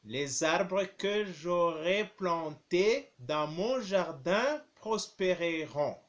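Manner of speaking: ordinary talk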